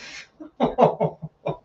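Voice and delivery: high, breathy burst of laughter; helpless high chuckle; mocking laugh. Real, not acted. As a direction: laugh at the whiner. Laughing at the whiny character.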